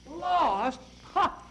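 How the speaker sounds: high, mocking echo with a scornful laugh; scoffing. Uncle Al scoffing at Captain Windy's fear of getting lost. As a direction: scoff at the very idea.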